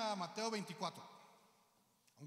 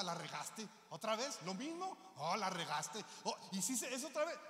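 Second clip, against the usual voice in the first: mock accusation. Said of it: "El enemigo no nos está acu".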